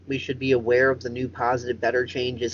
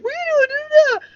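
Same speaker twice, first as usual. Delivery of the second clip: shrill, much higher mock mom; imitating angry mom; 6 dB louder, faster than usual. Retelling Jasmine's mom catching them in a high mock-mom shriek. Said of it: "her mom came in and was like".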